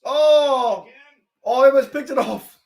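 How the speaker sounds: high-pitched fan outburst; excited exclamation. Real, not acted. Fan watching a near interception, excited high cry.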